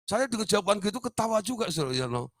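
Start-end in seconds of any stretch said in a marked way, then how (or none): none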